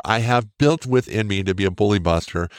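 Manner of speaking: normal talking voice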